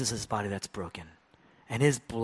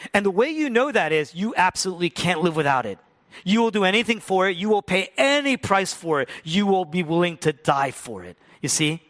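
higher, louder, fast preaching; fervent preaching; 8 semitones higher, 9 dB louder than usual. Real, not acted. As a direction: drive the point home hard. Preaching at full force.

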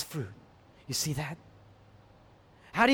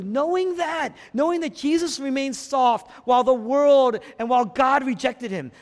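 high, loud, impassioned pulpit surge; full-force preaching; 9 semitones higher, 7 dB louder than usual. Real, not acted. Preacher presses the congregation to be moved, full-force preaching. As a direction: urge it on the listeners.

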